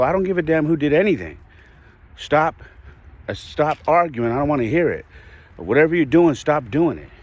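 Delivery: retold exasperated scolding, pitched high; snapping dad. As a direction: re-enact a driving father snapping at squabbling kids. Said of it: "snap at you".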